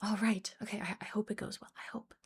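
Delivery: a small, timid whisper, almost voiceless; unsure hoping. Retold or imitated. Coach showing the wrong anxious mindset, whispered timidly. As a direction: barely dare to say the hope aloud. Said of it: "this place of".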